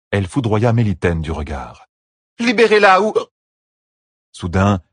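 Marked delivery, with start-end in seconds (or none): 2.4-3.1 high, loud demand cut short; choked-off shout. A narrator's reading. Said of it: "sa voix s'étrangla".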